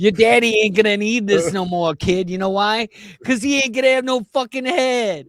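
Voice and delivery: put-on Italian accent. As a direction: taunt the kid like an Italian gangster. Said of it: "funnier to say it in that voice".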